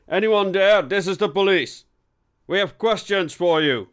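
loud, high-pitched call through a door; police call-out. Acted. Inspector slams his fist on the door, calling out loudly.

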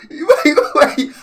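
ordinary talk

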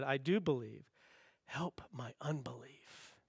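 hushed, pleading whisper; desperate plea. Retold or imitated. Pastor voicing the desperate father's hushed plea to Jesus.

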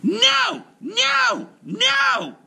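very high shrieked nos; screaming. A narrator's reading. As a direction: scream each no in a rage. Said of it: "she just screamed".

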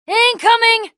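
high, drawn-out battle warning; urgent warning cry. Acted. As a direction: shout a warning to the group. Warning of an attack.

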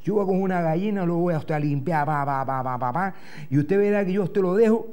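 ordinary talk, nothing marked

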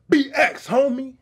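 high, loud imitation of a street holler; distant call-out. Storyteller mimics a stranger hailing the crew, calling out. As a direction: mimic someone hollering from afar. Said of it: "off in the distance, we hear".

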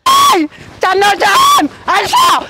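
long-drawn debt collector's shout; insistent yelled demand; yelling. Acted. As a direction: holler it out, stretching the last word. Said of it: "mà gào ghê thế".

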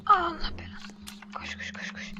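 panicked whisper while fleeing; being chased. Real, not acted. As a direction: whisper urgently, run run. Fleeing the monster.

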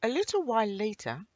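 plain reading voice

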